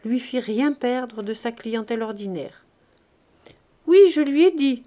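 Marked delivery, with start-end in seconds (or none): 3.9-4.8 put-on hoarse boy. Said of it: "d'une voix enrouée".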